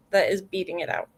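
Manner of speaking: ordinary talk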